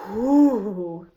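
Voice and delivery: usual speaking voice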